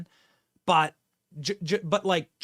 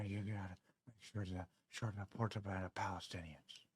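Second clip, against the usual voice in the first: put-on softer, lower mumbling old president who loses the sentence; Joe Biden impression; 7 semitones lower, 10 dB quieter than usual. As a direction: trail off mid-thought, mouth hanging open, soft mumbled rambling. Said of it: "a step-by-step Joe Biden impression".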